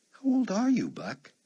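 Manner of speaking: a playful lift on the question; teasing question. Acted. Chloe teasingly asks his age.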